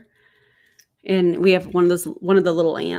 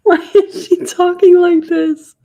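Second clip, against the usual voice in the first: incredulous outburst; 10 semitones higher, 9 dB louder than usual. Real, not acted. Reacting in disbelief to a clip.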